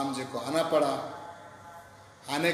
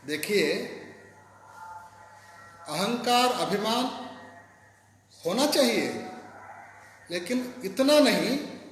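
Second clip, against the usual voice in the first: angry rebuke; 5 semitones higher than usual. A narrator's reading. Hanuman rebuking the stubborn storyteller, angry raised voice. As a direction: scold him, voice climbing high. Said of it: "Hanuman जी को जब गुस्सा आया".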